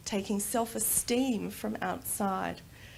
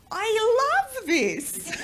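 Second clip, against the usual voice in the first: put-on higher, louder, slow little boy with a laugh; imitating her son; 12 semitones higher, 6 dB louder, much slower than usual. Mother imitates son answering her, happy childlike voice.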